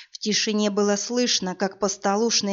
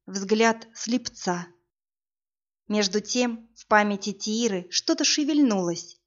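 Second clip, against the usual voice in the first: usual speaking voice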